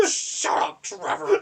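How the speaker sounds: high, loud scream of the line. Retold or imitated. Turning on his co-actor, screaming. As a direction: scream it straight at him. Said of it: "He just turns to me and screams".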